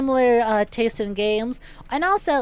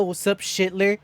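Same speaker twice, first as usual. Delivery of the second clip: 1 cool Duke greeting; 4 semitones lower than usual. Retold or imitated. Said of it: "if you have heard me go".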